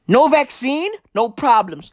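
normal talking voice

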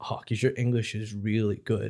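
imitated American tourist; mimicked American compliment; American accent. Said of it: "and the guy went".

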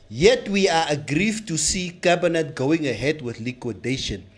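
reading an official press statement; solemn, deliberate statement delivery; formal statement reading. Acted.

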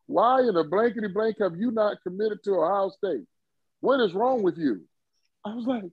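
mock-furious recruiter lecturing a stubborn kid; Woody Hayes chewing him out. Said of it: "He's cursing me out".

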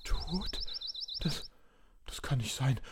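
barely voiced disbelief; numbed, almost no voice; soft shocked murmur; stunned hush. Hears friend is dead.

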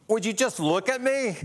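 high-pitched, exasperated mock demand; mock exasperation. Preacher voicing what Jesus doesn't say, mock exasperation. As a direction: demand attention in put-on exasperation.

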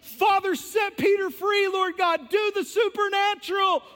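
high, loud shouted prayer; loud fervent prayer. Acted. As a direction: cry out to God.

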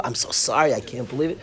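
low, hushed condolence; subdued sympathy. Retold or imitated. Consoling someone who shared bad news, subdued sympathy.

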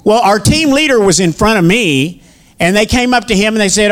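ordinary delivery

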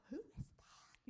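hushed whisper; whispered question. Retold or imitated. Wife whispering to her husband during the call, hushed. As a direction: whisper so the caller can't hear. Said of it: "And I was like".

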